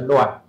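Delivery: “乱” as the literary reading of 两, second tone. Shown as a demonstration. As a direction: give “乱” as the reading pronunciation, tone two. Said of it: "读册音是念作".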